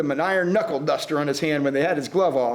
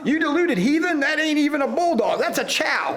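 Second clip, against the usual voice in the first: exasperated Costigan; 8 semitones higher than usual. A narrator's reading. Costigan angry at dog catcher.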